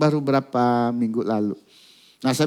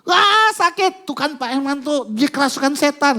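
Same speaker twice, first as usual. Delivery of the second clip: much higher yelp of pain, then accusing; pained complaint; 12 semitones higher, 4 dB louder than usual. Retold or imitated. Father complains to Pak Herman that she is possessed, high pained cry. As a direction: cry out, then point at the demon. Man pained, blaming demon.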